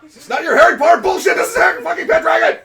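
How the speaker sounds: high, loud laughing yell; laughing shout. Real, not acted.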